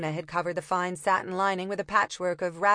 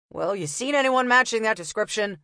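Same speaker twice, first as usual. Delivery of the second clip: louder, pushy copper; impatient copper; 3 semitones higher, 4 dB louder than usual. Acted. Copper pressing the rag picker, impatient.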